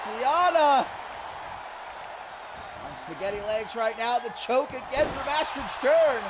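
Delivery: high, building wrestling commentary; live play-by-play. Real, not acted. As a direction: call the finish at full excitement. Calls the wrestling finish live.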